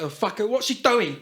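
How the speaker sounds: high-pitched Cockney impression; Cockney accent. Shown as a demonstration. Showing off accents to friends, high Cockney voice. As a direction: burst into a broad Cockney accent. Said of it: "I can do the Cockney".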